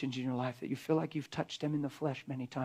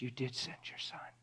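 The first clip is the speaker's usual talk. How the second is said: whisper; 3 semitones lower, 11 dB quieter than usual. Acted. World's awed realisation.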